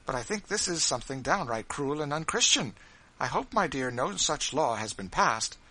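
a hint of a timid, troubled wife; Mrs Bird.

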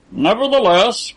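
mild anger; touch of anger. A narrator's reading. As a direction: cut off their rejoicing. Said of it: "with a touch of anger".

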